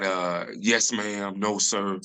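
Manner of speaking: ordinary talk, nothing marked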